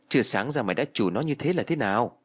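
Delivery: plain reading voice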